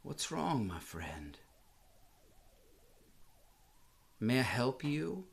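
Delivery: gentle concern. Retold or imitated. Comforting weeping stranger.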